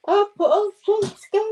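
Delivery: high, loud delighted thanks; bright excited gratitude. Real, not acted.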